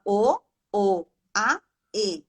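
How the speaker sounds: "o", "o", "a", "e" as separate vowels with gaps; broken into monosyllables. Retold or imitated. Therapist shows how the child says chocolate, broken into single vowels. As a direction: imitate a child saying only the vowels "o, o, a, e", one at a time. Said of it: "ele só fala monossílabo".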